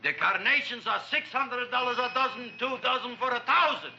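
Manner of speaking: high, excited price announcement; sales pitch. Acted. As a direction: call out the prices like a grand offer. Acting out the grand sales line.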